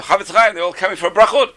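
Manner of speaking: lifted, excited storytelling call; crowd calling out. Retold or imitated. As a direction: imitate an eager crowd greeting a sage. Crowd calls to the rabbi.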